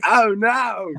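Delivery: high comic dismay; mock dismay. Teen about to get beaten, high mock dismay. Echoes listener's dismayed reaction.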